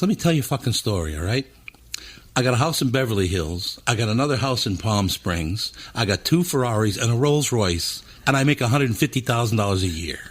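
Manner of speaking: usual speaking voice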